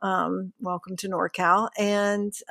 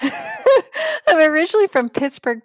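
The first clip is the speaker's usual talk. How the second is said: laughing; 10 semitones higher, 7 dB louder than usual. Real, not acted. Laughing while naming hometown.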